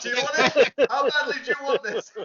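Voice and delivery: high, loud mock dare through laughter; laughing taunt. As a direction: play at goading someone, cracking up. Listener playfully taunts as imagined customs.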